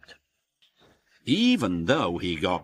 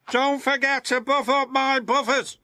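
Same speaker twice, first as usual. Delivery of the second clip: high, cheerful vain engine voice; delighted at being pampered; happy tooting James; 6 dB louder than usual. Acted. James at the washdown, high happy toot. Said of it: "he tooted happily".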